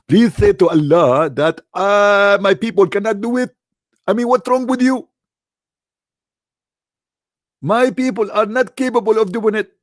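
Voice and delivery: plead, then lose patience; mock exasperated plea to the angel. Muhammad pleading, exasperated again.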